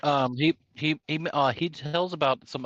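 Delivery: talking while chewing; words around a mouthful; chewing steak. Real, not acted.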